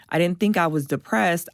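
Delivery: ordinary talk, nothing marked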